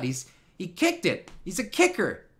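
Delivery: ordinary talk, nothing marked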